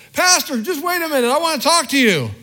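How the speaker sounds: high, loud call across the parking lot. Retold or imitated. Complaining member calls the pastor across the parking lot, high loud shout. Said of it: "from across the parking lot".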